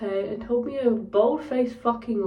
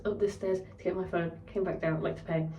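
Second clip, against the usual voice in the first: ordinary talk, nothing marked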